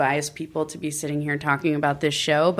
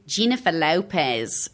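Australian accent with dropped R; 3 semitones lower than usual. Shown as a demonstration. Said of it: "get into the accent".

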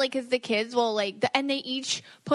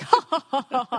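loud mock work-call laugh; imitating a forced business laugh; fake laugh; 3 semitones higher, 4 dB louder than usual. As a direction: put on a hearty, phony ha-ha. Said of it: "like, this fake laugh".

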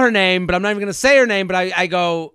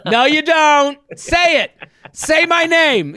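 commanding; 7 semitones higher, 3 dB louder than usual. Retold or imitated. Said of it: "dominatrix situation".

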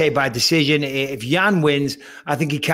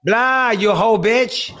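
slow, high mock New Zealand accent; hammed-up New Zealand accent; 7 semitones higher, much slower than usual. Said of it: "I can't do a New Zealand accent".

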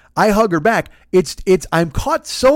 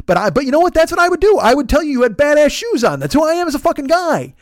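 loud, high ranting protest; comically heated self-justifying rant; worked-up self-defence; 11 semitones higher, 5 dB louder than usual. Pretend. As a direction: insist at the top of your voice.

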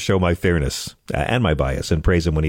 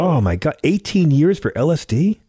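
high, slowed, stunned question; can't believe what you just heard; shocked disbelief; 8 semitones higher than usual. Real, not acted. Shocked at friend's prison sentence.

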